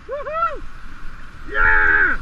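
high whoop into loud yell; whoop, then holler at full volume; excited cheering. Real, not acted. Cheering a friend's run.

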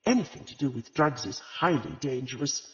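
usual speaking voice

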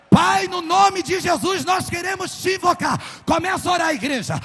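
high, loud shouted prayer; fiery preaching prayer. Acted. Pastor leading the church in prayer, shouting at full force.

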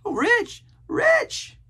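very high, loud castaway cries; hot insistence. A narrator's reading. Castaway insisting to a doubting boy, hotly. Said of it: "repeated the statement hotly".

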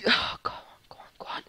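hushed, barely audible pleading; very soft anxious whisper; urging whisper. Real, not acted. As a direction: whisper it under your breath, willing it to happen. Willing the trade to go through.

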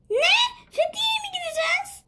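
high squealing surprise; excited disbelief. Acted. Daughter squeals at news of the trip, thrilled and high. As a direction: gasp out the question in delight.

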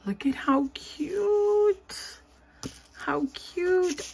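high, slow, breathless whisper of delight. Real, not acted. Unboxing a friend's crafty gift, high delighted whisper.

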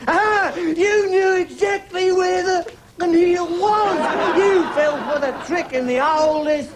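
drawn-out, high-pitched gotcha; triumphant accusation. Acted. Police officer catching a suspect out, triumphant accusation. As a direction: pounce on the slip, stretching the words.

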